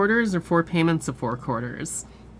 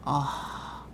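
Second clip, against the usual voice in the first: ordinary delivery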